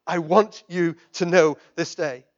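ordinary talk, nothing marked

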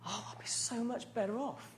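whispered secret thought of an inheritance; greedy whisper. Pretend. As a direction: whisper it like a guilty wish. Imagined greedy inner thought.

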